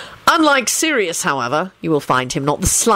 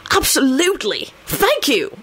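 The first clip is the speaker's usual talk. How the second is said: eager, high-pitched thanks; enthusiastic; 9 semitones higher than usual. A narrator's reading. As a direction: accept with keen enthusiasm. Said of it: "Lupin said enthusiastically".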